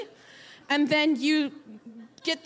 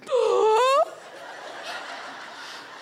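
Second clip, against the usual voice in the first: [name] gasp; 7 semitones higher than usual. Retold or imitated. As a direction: gasp back to life like surfacing from sand. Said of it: "I go like this".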